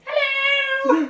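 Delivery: very high, loud shrieked "Hello!" in a bad all-purpose accent; mock accent hello. Mocking the show's identical accents with a shrill "Hello!". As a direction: squeal "Hello!" like a terrible generic foreign accent. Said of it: "Just going".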